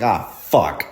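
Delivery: lightly acted dismayed dad, cursing in bursts; mock a dad dreading bad news, spacing out the swears; mildly panicked father imitation; freaking-out dad. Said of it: "he was freaking out".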